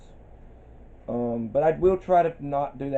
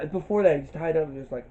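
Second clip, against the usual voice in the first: ordinary delivery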